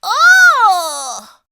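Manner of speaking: high, loud mock sigh; sigh, disheartened. Splat sighing about the prize, mildly sad. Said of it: "Splat sighed".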